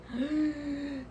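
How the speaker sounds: imitated gasp of dread; panicked gasp. Student told to start the driving test, panicked gasp. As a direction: suck in a frightened breath.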